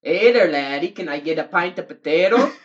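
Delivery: loud, hammed-up Irish barfly; mock Irish accent. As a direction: call for a pint in a put-on Irish brogue. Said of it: "mock Irish pub talk".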